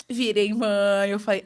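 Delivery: ordinary delivery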